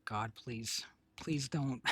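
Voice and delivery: ordinary talk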